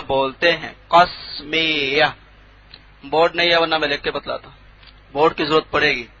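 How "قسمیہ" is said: drawn out syllable by syllable; slow dictation. Shown as a demonstration. Teacher dictating a term without a board, slow dictation. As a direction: spell out قسمیہ slowly by ear.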